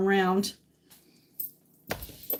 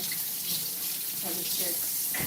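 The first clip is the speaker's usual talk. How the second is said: whisper; 5 semitones lower, 13 dB quieter, much slower than usual. Real, not acted. Trailing tired aside.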